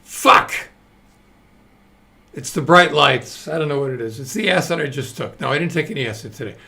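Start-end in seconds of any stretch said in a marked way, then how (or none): 0.0-0.7 loud, sudden expletive; real burst of frustration; frustrated curse. Real, not acted. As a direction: let out a loud curse at your own flub. Curses after botching the punchline.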